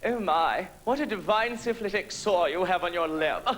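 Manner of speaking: put-on British accent. Complimenting a British person on a sore, British accent. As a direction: coo it admiringly in a mock British accent. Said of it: "a person with a British accent and say something like".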